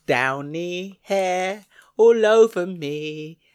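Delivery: slow, creepy sing-song madman voice; drawn-out creepy lullaby tone; unhinged villain. Pretend. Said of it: "Talking like a madman and doing sing-song bloody creepy lullabies".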